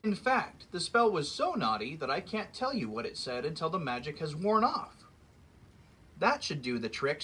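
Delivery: lively read-aloud storybook voice; story narration. A narrator's reading. Reading a fairy tale to children, lively storyteller voice. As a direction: read it like story time for kids.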